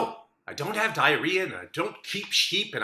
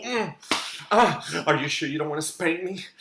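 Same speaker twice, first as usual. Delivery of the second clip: ordinary talk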